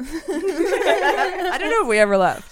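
high, slow, laughing through it; giggling disbelief. Real, not acted. Host jokes they never left, laughing. Joking about being back, laughing.

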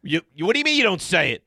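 loud, high-pitched disbelief; stammering, high and loud protest; incredulous challenge. Real, not acted. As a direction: call out a co-host indignantly. Challenges co-host's denial.

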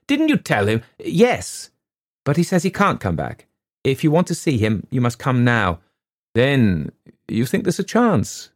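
clipped worry between them; agitated exchange. A narrator's reading. Father and daughter about the buyer, tense.

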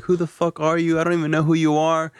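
put-on high, snotty teen sneer; bratty attitude. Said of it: "girl had such an attitude".

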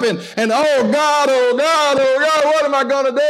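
high, loud hammed-up fretting; mock panic. Pastor mimicking a panicked worrier, hammed-up fear. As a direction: wail like a worrier.

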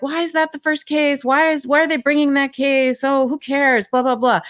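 high, whiny mockery; mock the complainers, whining; mocking whiny critics. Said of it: "Everybody who's like".

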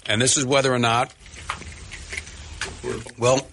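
talking while chewing; words round a bite of burger; mouth full. Real, not acted. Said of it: "I'm just chewing the first one now".

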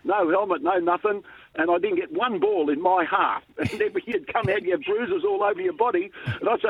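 ordinary talk, nothing marked

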